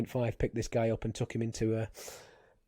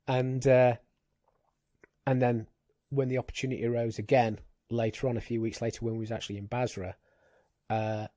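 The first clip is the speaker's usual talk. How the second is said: ordinary delivery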